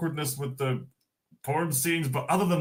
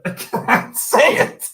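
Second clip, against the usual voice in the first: wheezing the words out through laughter, higher and louder; can barely get it out for laughing; breathless laugh; 11 semitones higher, 7 dB louder, slower than usual. Real, not acted. Giving up on a line to chat, laughing.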